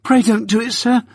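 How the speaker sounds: put-on a frightened little boy begging for his life; pleading in terror. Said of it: "I pleaded in terror".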